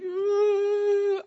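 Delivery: very high, long, held wail; keen on one high note; imitated wail. Filled with cries of heaven, imitated wail. Imitating her wailing for the lost.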